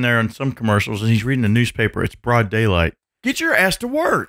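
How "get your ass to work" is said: mock yell. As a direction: bark it at a lazy dad. Mocking lazy dad in commercial.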